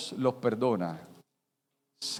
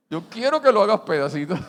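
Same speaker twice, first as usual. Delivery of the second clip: vengeful prayer; 7 semitones higher, 5 dB louder than usual. Pretend. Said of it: "está diciendo".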